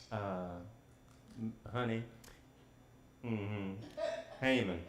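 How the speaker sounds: hesitant accusation. Retold or imitated. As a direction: hold back, then let the name slip. Esther hesitantly naming Haman.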